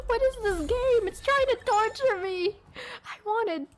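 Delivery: high, indignant complaining; outraged gacha complaint; exasperated whine. Real, not acted. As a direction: rant at the game in disbelief. Complaining about bad pull.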